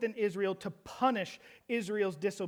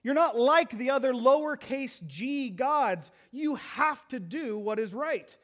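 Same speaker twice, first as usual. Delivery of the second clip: higher, louder and slow, ending in a shout; loud plea; 6 semitones higher, 6 dB louder than usual. Acted. Habakkuk crying out to God, loud and slow. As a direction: demand it of God with full force. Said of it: "My Holy One says".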